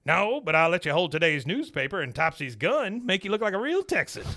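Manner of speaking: lightly voiced cranky dad; Cotton's voice. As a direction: bark it like a scheming old vet. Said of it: "Cotton answers".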